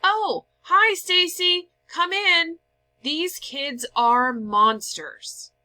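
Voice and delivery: high, loud call across the house; loud cheerful yell to a friend arriving; yelled greeting. A narrator's reading. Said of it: "She yelled".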